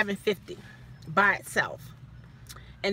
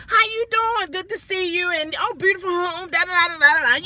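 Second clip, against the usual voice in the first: higher, overly friendly greeting voice; mimicking cheery manager; 6 semitones higher than usual. Mimicking the manager's cheery hello on arrival, higher pitch. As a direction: lay on the fake cheer.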